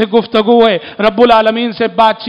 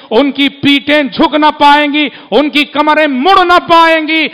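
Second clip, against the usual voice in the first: louder and higher, fiery preacher's cry; preaching at full force; 8 semitones higher, 7 dB louder than usual. Acted. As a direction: thunder it out to the congregation. Sermon on those denied prostration.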